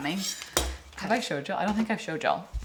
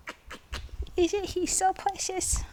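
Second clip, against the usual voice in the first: cooing to the dog; 10 semitones higher, 5 dB quieter than usual. Real, not acted. Talking to her dog, high cooing pet voice. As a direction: coo at your little pup, much higher.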